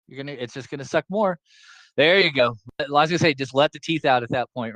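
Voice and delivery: ordinary talk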